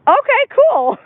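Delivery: high, loud "okay, cool" breaking into laughter; amused outburst. Real, not acted. Streamer reacting to a meme-like dog, amused high outburst. Reacting to an amusing passing sight.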